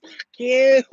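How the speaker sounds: high, loud mock scream; imitated scream. Older woman cursing at a driver going by, imitated scream. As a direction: mimic an old woman screaming. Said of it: "screaming at top of her lungs".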